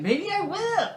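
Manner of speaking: very high mock-child retort; defiant kid. Kevin answering mom about the family wish, defiant kid voice. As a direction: talk back in a squeaky kid voice.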